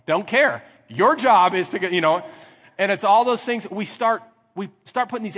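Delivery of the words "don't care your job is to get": loud, higher dismissive retort; yelling at wife. Acted. Husband to wife with a broken leg, yelling. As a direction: cut off her excuse loudly. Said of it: "Now I'm yelling at her".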